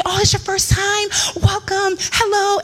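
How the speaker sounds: high-pitched, gushing welcome; excited greeting. Retold or imitated. Park staff greeting a first time visitor, excited. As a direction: imitate an overjoyed greeter. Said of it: "they were just really excited".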